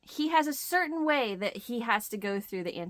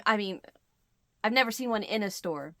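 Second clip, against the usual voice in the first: ordinary talk, nothing marked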